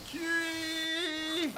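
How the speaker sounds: imitating a yell. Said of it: "Wolf says".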